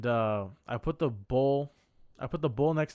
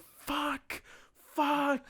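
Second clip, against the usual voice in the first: very high, held-out mock scream; stretched, high cries of frustration; retold scream; 14 semitones higher than usual. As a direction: scream it in falsetto, holding back the volume. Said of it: "I just think I screamed".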